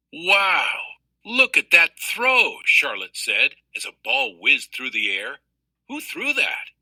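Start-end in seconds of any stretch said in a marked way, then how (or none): 0.1-2.6 high, slow amazed exclaim; wide-eyed wow, drawn out; excited. A narrator's reading. Charlotte marvels at a throw. 5.9-6.7 much higher, amazed question; a girl gasping who threw that; surprised. A narrator's reading. Charlotte asks about the thrower.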